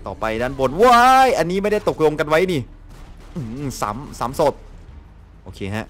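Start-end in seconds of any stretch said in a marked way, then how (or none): 0.8-1.3 loud, high yelp of surprise; startled shriek. Real, not acted. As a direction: shriek as if caught off guard. (ร้องว้ายเสียงสูงด้วยความตกใจ)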